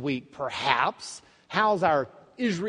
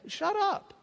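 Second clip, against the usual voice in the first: mock scolding, pitched way up; sharp rebuke. Crowd rebuking the blind men, high-pitched mock scolding. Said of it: "The crowd rebuked him".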